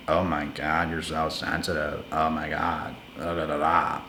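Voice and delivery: hammed-up mock of a belittling partner; mocking imitation. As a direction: sneer it like a partner waving you off. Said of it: "They try to mock you".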